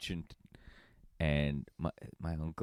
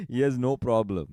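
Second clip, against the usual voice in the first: ordinary delivery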